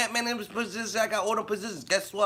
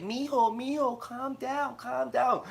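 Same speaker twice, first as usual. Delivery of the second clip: a light imitation of an older lady, pitched higher and soft; soothing older lady; 6 semitones higher, 3 dB quieter, much slower than usual. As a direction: lightly voice a kind coworker calming him down. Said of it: "the lady wants to come over and be like".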